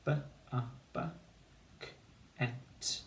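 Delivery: each sound of bucket said separately. Shown as a demonstration. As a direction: build bucket from isolated sounds. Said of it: "Two syllables".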